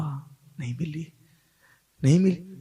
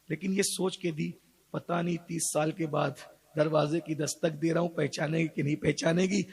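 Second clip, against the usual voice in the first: low, soft narration; narrating the night return home, softly; hushed storytelling; 5 semitones lower, 4 dB quieter than usual. Acted. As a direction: draw the listeners in quietly.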